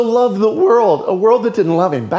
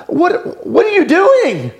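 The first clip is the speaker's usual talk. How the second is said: much higher, startled what are you doing; perplexed; 10 semitones higher, slower than usual. Retold or imitated. A daughter baffled as her father grabs his belt, high and startled. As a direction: squeak it out, baffled and alarmed. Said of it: "this look of perplexed puzzlement".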